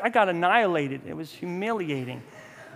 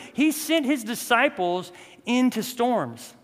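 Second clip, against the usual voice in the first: ordinary talk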